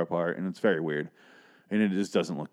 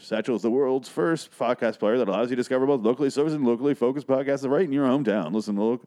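high, hyped radio-ad voice; host reading the sponsor script, hyped announcer voice; 3 semitones higher than usual. Acted. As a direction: sell it like an overexcited announcer, much higher. Reads sponsor ad aloud.

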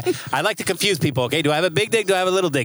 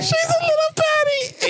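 a mock-swooning high voice; high gushing squeal; slower than usual. Gushing about his favourite, high and slow.

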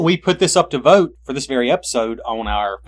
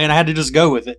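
ordinary delivery

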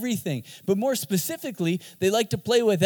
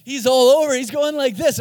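usual speaking voice

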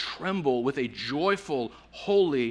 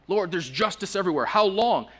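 faster, louder, pitch climbing on how long; impatient outcry; 6 semitones higher, 6 dB louder than usual. Retold or imitated. The prophet complains to God, rising impatient outcry. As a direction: let the impatience boil over. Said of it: "a nuance of impatience".

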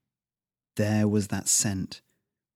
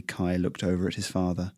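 plain reading voice

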